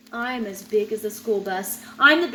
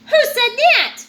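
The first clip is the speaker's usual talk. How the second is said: higher and louder, startled; startled question; 12 semitones higher, 7 dB louder than usual. Acted. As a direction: jump at an unseen voice. Startled shark hears a hidden voice.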